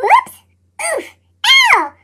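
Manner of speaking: ordinary delivery, nothing marked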